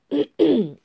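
loud, deliberate throat clear. Acted. October clears his throat before opening the meeting. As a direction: clear the throat to call order. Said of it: "cleared his throat".

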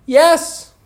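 high, loud answer; shouted. A narrator's reading. Alice shouts her answer to the Queen. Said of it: "shouted Alice".

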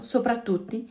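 usual speaking voice

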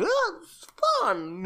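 high, soft, slow singsong; goofy carefree partier. Retold or imitated. Mocking a man dangling off the train, airy goofy voice.

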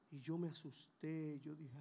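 ordinary delivery

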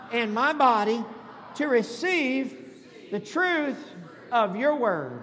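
loud call-and-repeat prayer in short phrases; bold declaration. Shown as a demonstration. Pastor leading congregation with Bibles held up, bold declaration. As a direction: declare it loudly, pausing for the crowd to echo. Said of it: "I want you to boldly declare".

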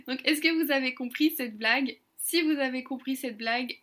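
ordinary talk

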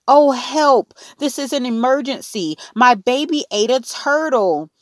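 high, urgent cry for help; panicked cry. A narrator's reading. Mother phoning the operator about her baby, panicked. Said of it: "dialed the operator and cried".

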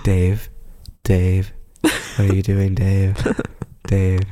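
slow, level computer voice pleading; spaced-out, deliberate movie-computer delivery; HAL. Acted. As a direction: intone like a calm computer being shut down. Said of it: "As he's unplugging everything".

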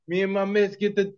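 put-on British accent stuck in Jamaican; botched British accent. Host retries British, botched accent that comes out Jamaican. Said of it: "I'm stuck in the Jamaican accent".

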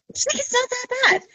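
high, dismissive friend impression; high-pitched reassurance. Retold friend repeats it, much higher.

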